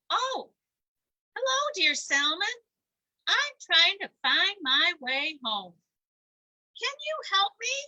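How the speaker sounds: high, loud fishy voice; squeaky pleading salmon; high, loud little salmon asking for help. Acted.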